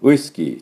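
ウイスキー with lips pursed on ウイ; rounded W. Shown as a demonstration. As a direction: hold back, then round into ウイスキー. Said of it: "ちょっと違いますよね". (唇をすぼめて溜めるウイスキー)

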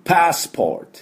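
crisp teacher's demo of "パスポート" in English, pitched high; English pronunciation. Shown as a demonstration. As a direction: model "パスポート" as English passport, open "a", r-coloured "or". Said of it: "これを二つ合わせて".

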